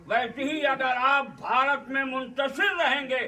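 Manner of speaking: high, driving sermon delivery; rousing preaching. Acted. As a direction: build forcefully to a rallying climax. Preaches unity with fist parable.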